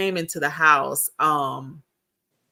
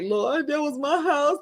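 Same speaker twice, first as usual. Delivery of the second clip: high, mock-tearful plea; imitated weepy lament; crying retold; 9 semitones higher than usual. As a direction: whine it up to the Lord. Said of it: "big crocodile tears".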